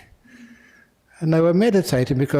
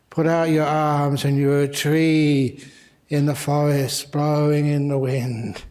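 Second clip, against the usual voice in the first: drawn-out, slow teacher's prompt; slow guided imagining. Retold or imitated. Primary teacher leading kids to imagine being trees, slow and drawn-out. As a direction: stretch the words out slowly, gently leading an imagining game.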